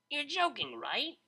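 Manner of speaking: high, loud, teasing disbelief; brash DJ voice. Acted. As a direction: play the cocky party girl, incredulous. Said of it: "she'd say".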